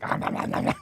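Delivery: whispered nom nom munching; munching noises. Retold or imitated. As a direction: gobble like a greedy kid. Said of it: "was just like".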